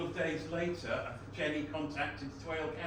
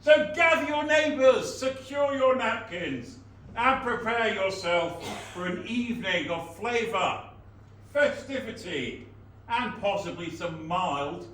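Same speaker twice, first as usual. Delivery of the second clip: town crier; 8 semitones higher, 8 dB louder than usual. Acted. Town crier audition proclamation.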